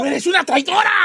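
high-pitched, shouted mock accusation; acusación dramática. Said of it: "las de la tribu decían a ella". (acusación a gritos, exagerada)

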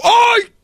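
very high, sudden yelp; mock cry at a small cut; yelp of pain. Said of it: "le cortó un poquito". (grito agudo de dolor)

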